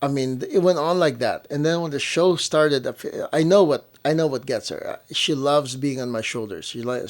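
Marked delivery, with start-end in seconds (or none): none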